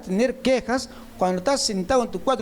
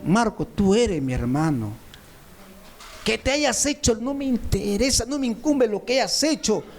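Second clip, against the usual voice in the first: normal talking voice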